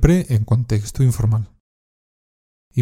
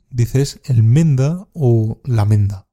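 "el menda o la menda" said slowly and evenly; slow teacher model phrase; slow clear model; slower than usual. Shown as a demonstration. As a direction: lay out both forms slowly. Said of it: "En vez de decir yo".

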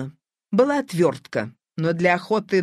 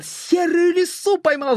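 higher, exultant cry of triumph; triumphant shout; 9 semitones higher than usual. A narrator's reading. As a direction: cry out the catch in high-pitched delight. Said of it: "— закричал Шамов".

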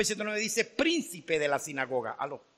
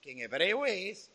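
ordinary talk, nothing marked

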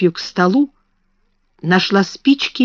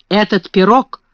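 plain reading voice

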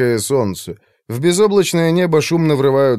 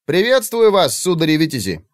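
playful; 6 semitones higher than usual. A narrator's reading. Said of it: "озорно произнёс парнишка".

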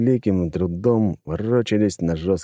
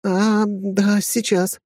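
put-on flustered young woman; 13 semitones higher, much slower than usual. A narrator's reading. Flustered female.

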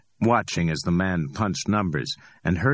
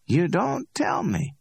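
higher, amused street hustler voice; cocky Ordell. Acted. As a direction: play Ordell, high and pleased with himself. Ordell, high and slow.

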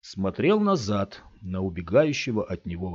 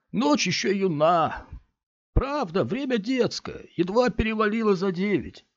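coaxing stranger; 11 semitones higher than usual. A narrator's reading. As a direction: lure the boy to stay. Big man coaxing Scott.

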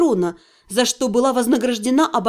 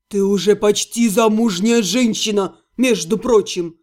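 low, reproachful male voice; grumpy half-gnome; 5 semitones lower than usual. A narrator's reading. Otto scolding the heroine about flirting, low reproach. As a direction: lecture her like a jealous friend.

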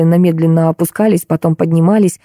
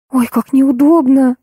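put-on high, drawn-out girlish fluster; blushing girl, pitched up and slow; embarrassed girl; 7 semitones higher, slower than usual. A narrator's reading. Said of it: "снова залилась краской Маша". (смущённая девушка, высоко и протяжно)

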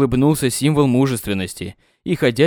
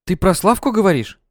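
indignant; 6 semitones higher than usual. A narrator's reading. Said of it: "Праведно возмутилась Катя".

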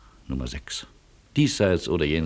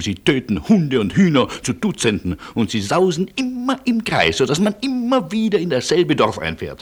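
rising higher and higher in exasperation; an exasperated rant that climbs higher; building tirade; 8 semitones higher than usual. Acted. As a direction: pile up the complaints until the voice climbs. Berates the colonel's reckless driving.

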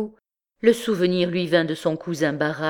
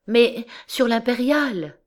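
higher, anxious wife's voice; anxious answer; 6 semitones higher than usual. A narrator's reading. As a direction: answer anxiously, stretching the last word. Wife answers the conductor, distressed.

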